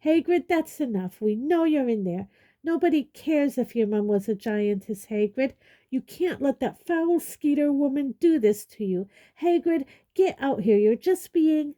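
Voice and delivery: high, loud, insistent shouting. Acted. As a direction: yell at him to come out. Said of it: "what Miss Granger was shouting".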